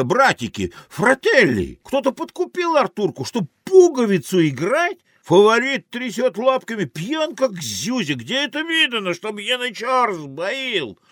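high, agitated complaint; indignant outcry. Acted. Beauty to her brothers about bribery, high indignant outcry. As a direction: protest at a high, excited pitch. Indignant woman stirs up the sailors.

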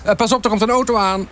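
high-pitched heads-up; warning call. A narrator's reading. Colleague warning the photographer of a car, calling out. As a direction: call out a warning across the street. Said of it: "waarschuwde Jeroen Kloosterman".

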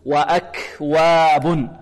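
very slow وأكواب with air after k; chanted وأكواب, aspirated kaf; breath on the kaf. Shown as a demonstration. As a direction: draw out وأكواب, releasing a breathy k. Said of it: "الهمس في الكاف فهو موجود".

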